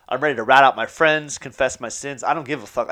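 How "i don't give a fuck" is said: normal talking voice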